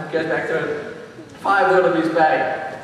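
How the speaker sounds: usual speaking voice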